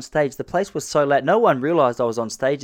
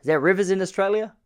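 asking; 9 semitones higher than usual. Retold or imitated. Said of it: "got asked".

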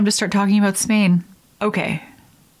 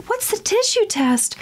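much higher naive ask; mock curious customer; 13 semitones higher than usual. Customer in toothpaste ad, high naive question. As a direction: play dumb like a cheesy ad. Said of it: "And they're like".

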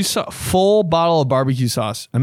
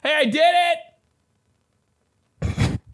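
blurted confession. Retold or imitated. Calling to confess.

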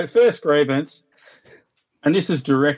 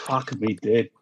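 ordinary talk, nothing marked

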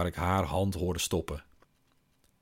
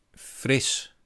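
strained correction; 6 semitones higher than usual. Acted. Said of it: "Waarom praat je dan zo raar".

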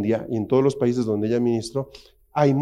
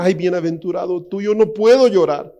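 mock whiny complaint; 4 semitones higher, 5 dB louder than usual. Mocking someone who can't cry.

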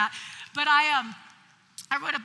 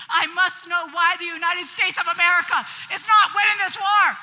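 sarcastic mock urgency; 4 semitones higher, 9 dB louder than usual.

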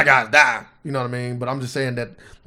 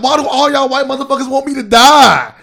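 raised mock-indignant roast voice; playing the angry comic, pitched up; quoting Patrice on stage; 9 semitones higher, 6 dB louder than usual. Comic retelling his roast, mock indignant. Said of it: "He's like".

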